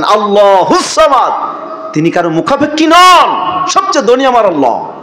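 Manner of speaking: impassioned sermon declaration; fervent proclamation. Real, not acted. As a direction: proclaim it high and let the endings fall. Preacher's emphatic proclamation.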